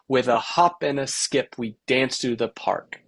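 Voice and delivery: "with a HOP and a SKIP" in bouncing da-da-DUM; three-beat rhythm. Shown as a demonstration. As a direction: land the beat on HOP, SKIP, DANCED, PARK. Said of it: "you can shape any sentence this way".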